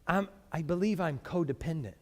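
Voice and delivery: soft, meek mock confession; sheepish whisper-like admission. Pastor imitating a counselee, soft and meek. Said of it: "they would say something like".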